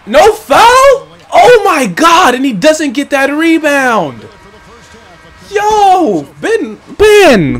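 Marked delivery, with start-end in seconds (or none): 0.1-4.3 high loud No foul, slow Oh my God; outraged disbelief. Real, not acted. Gamer yelling at the referee's no-call, high and loud. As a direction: yell at the no-call.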